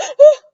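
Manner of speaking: sharp, high, loud imitated gasp; imitating Marlena's shocked gasp. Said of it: "Marlena was like".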